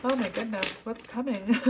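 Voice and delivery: overcome, words tipping into loud laughter; exclaim, then burst out laughing; teary, giggly disbelief; laughing and crying. Real, not acted. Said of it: "laughing and crying".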